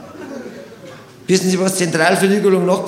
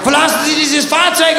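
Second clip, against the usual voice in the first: shouted order; 6 semitones higher than usual. Retold or imitated. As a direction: bark the order at the driver. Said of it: "hat er weggeschrien". (laut gebrüllter Befehl)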